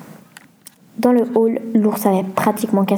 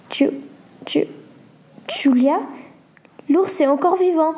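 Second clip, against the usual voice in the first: acted stammer, then higher in alarm; 6 semitones higher than usual. A narrator's reading. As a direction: stumble on the first syllables with long gaps. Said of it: "bégaya Lucas". (bégaiement joué, voix qui monte)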